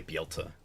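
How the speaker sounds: "be able to" as bee-ul-tuh. Shown as a demonstration. Streamer showing what they say, "be able to" as bee-ul-tuh. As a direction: drop the "able" of "be able to" down to ul. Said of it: "They don't even say the able".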